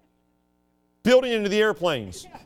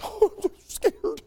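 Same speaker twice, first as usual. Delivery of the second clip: mock fright. Mocking today's frightened people.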